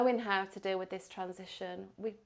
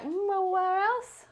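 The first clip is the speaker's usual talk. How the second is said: higher, slow, pondering; musing aloud; 11 semitones higher, 8 dB louder, slower than usual. Retold or imitated. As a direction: wonder where to go next. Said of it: "I'm like".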